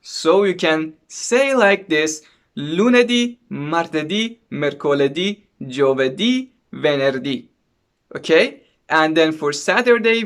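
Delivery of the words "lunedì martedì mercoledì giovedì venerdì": lunedì, martedì, mercoledì, giovedì, venerdì, each landing on the final "dì"; stress on last syllable. Shown as a demonstration. Teacher showing learners Italian weekdays, lunedì to venerdì stressed on the last syllable. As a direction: punch the closing "dì" in lunedì through venerdì. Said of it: "the stress is on the last syllable of the word".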